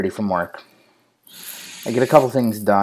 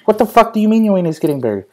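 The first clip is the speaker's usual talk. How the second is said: fast, high, louder retold outburst; shocked disbelief; 11 semitones higher, 3 dB louder, faster than usual. Dad retelling his reply to his son, mock shocked.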